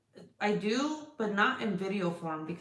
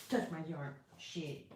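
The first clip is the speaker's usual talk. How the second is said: usual speaking voice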